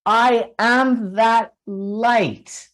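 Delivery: I am that light, very slow and drawn out; slow emphatic call. Shown as a demonstration. Leading the audience in a repeated affirmation, slow. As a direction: stretch each word for the crowd to repeat. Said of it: "Repeat after me".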